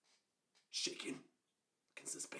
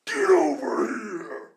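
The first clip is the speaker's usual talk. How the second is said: drawn-out, very loud angry roar; high, stretched furious mock-mom holler; Scorpion yell; 10 semitones higher, 17 dB louder, much slower than usual. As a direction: yell it like a fighting-game villain. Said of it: "she yells at me".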